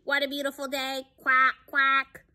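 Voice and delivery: high, quick, chattering duck. Acted. Noisy duck chats to her friend in the park, high quacky voice. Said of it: "shouts Dottie".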